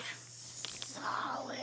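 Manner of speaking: long S into a tense whisper; drawn-out, stiff whispered word; hard and still. Shown as a demonstration. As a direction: whisper it slowly, body held rigid. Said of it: "Nice and hard and still".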